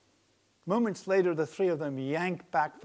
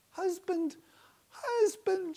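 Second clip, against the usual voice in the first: high voiced wife calling her husband softly; a high wife gently waking her man; 5 dB louder, slower than usual. A narrator's reading. Wife gently waking her snoring husband, calling softly. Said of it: "she calls out softly".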